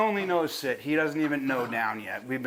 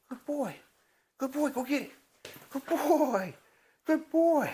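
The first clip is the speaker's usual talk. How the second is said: much higher praise voice; puppy praise; 10 semitones higher than usual. Real, not acted. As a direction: coo at the puppy.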